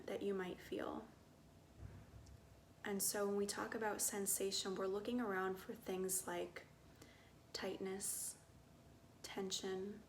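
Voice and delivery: soft, unhurried meditation voice with long pauses; guided meditation. Acted. Guide inviting listeners to get curious about body sensations, soft meditative calm.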